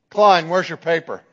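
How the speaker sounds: loud demand for the paper; stern demand. Retold or imitated. Professor going down the list of missing papers, loud and stern. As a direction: call the student out firmly.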